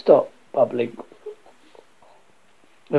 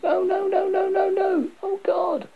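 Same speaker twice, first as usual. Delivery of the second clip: high, wailing moan; acted crying dread. A narrator's reading. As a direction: repeat it in a high panicked moan.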